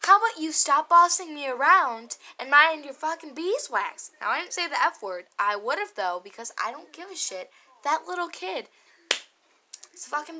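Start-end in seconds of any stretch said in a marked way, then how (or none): 0.0-4.1 loud, angry retold comeback; pissed comeback. Telling the ex-boyfriend to mind his business, a loud angry comeback. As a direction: snap back at him, loud and furious. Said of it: "so pissed".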